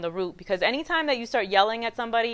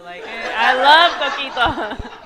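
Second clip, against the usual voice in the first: slow, loud mock gushing with a laugh; laughing exaggeration; 3 semitones higher, 10 dB louder, slower than usual. Husband loving the coquito, mimicked with laughing exaggeration. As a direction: stretch LOVE out, loud and laughing.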